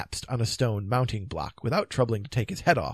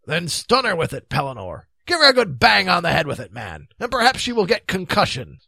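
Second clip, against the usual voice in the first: forceful, impatient knight, raised voice; brusque knight; 7 semitones higher, 9 dB louder than usual. Acted. Knight urging the king to stun the beast, brusque. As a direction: urge him on loudly and briskly. Narrator voices brisk Sir Grummore.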